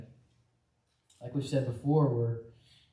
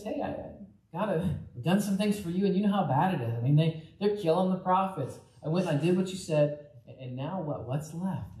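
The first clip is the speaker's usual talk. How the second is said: higher, pleading complaint to God; whining Elijah; 5 semitones higher than usual. Acted. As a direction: whine it up high like a worn-out prophet.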